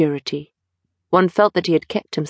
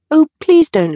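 high, loud, pleading cry; young man urgently begging a guest to stay; eager plea; 11 semitones higher, 6 dB louder than usual. Acted. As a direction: beg him not to leave. Dorian begs Lord Henry to stay.

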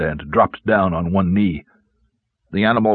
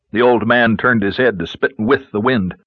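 ordinary delivery, nothing marked

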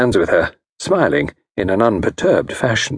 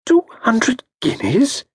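higher, slow incredulous question; aghast at the price; mild disbelief; shocked; slower than usual. Acted. Shocked at fee.

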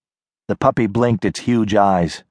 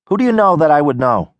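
fast, suspicious question; wary girl, quick; 6 semitones higher, 3 dB louder, faster than usual. Acted. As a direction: rattle it off, guarded. Nadia voiced, quick.

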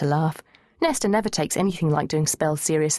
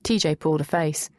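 ordinary delivery, nothing marked

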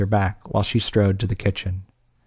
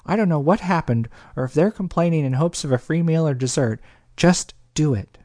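higher, lightly voiced, slowing on just do it; Belle, barely patient; 6 semitones higher than usual. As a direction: hold back the impatience, then land it. Tried not to let her impatience show.

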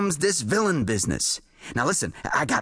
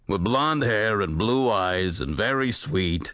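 slow, lower, resigned drone; hopeless; 7 semitones lower, slower than usual. A narrator's reading. Jules hopelessly guesses the heroine to the writer, slow and resigned. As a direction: drag it out, no hope left. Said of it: "said Jules hopelessly".

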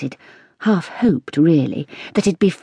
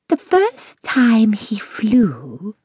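slow, hushed, secretive whisper; a wise old cat whispering to the girl; 5 semitones higher, much slower than usual. A narrator's reading. Cat Lilyleaf's voice.

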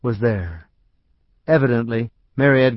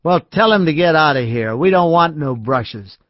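put-on drunk man; 4 semitones higher, 4 dB louder than usual. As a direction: throw him out with a slur. Drunk Art orders him out.

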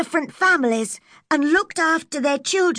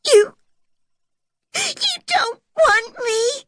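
high, slow, tearful sob; little girl sobbing she isn't wanted; 10 semitones higher, 3 dB louder, slower than usual. A narrator's reading. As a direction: choke the words out through tears. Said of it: "she sobbed".

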